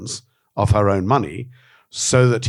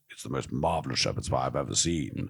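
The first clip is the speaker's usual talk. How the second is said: put-on much lower, softer grand old actor voice; a lowered, hushed Christopher Lee praising the pie; Christopher Lee impression; 7 semitones lower, 7 dB quieter than usual. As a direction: drop the voice low and savour it grandly. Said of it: "Christopher Lee goes".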